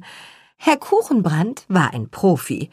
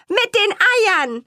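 audience calling out; 13 semitones higher than usual. Acted. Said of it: "rief das Publikum".

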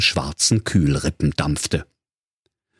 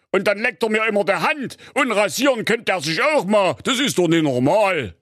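king voice; 11 semitones higher than usual. Acted. King complains about the dog.

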